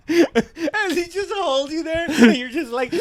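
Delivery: high, squeaky words through laughter; drawn-out, laugh-choked delivery; helpless laughter. Real, not acted. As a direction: barely get it out for laughing. Cracking up at his own bit.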